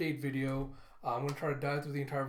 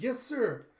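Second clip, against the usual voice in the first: high, loud eager reply; eager obedience; 10 semitones higher, 6 dB louder than usual. Acted. Tim twenty-two answering as he's walking off, eager. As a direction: pitch leaps up in keen obedience.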